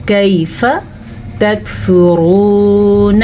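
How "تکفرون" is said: تکفرون with a long held "roo" and short "na"; full heavy ra, long vowel in تکفرون; stretched waw. Shown as a demonstration. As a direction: draw out the waw in تکفرون very slowly. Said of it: "کھینچ کے پڑھنا ہے واو کو".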